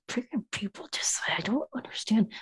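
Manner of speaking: unvoiced exasperated whisper. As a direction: whisper it under your breath. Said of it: "Poppy's just like".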